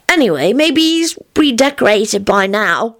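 put-on high child voice, a touch downcast; mildly wistful; sad. A narrator's reading. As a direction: console yourself sadly like a small child. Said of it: "he said sadly".

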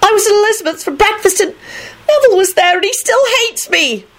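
very high tearful wail; high-pitched acted bawling. A narrator's reading. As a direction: wail it like a heartbroken girl. Rosie keeps wailing in tears.

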